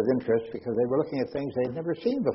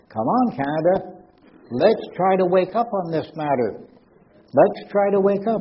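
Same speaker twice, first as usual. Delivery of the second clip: louder, higher rallying call; exasperated appeal; 7 semitones higher, 4 dB louder than usual. Real, not acted. Speaker scolding Canada over psychic TV shows, exasperated appeal. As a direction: plead with the whole country to wake up.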